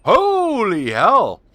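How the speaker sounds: loud, drawn-out holy hell, high and stunned; shocked exclamation. Real, not acted. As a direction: blurt it out in real shock. Sees something shocking in game.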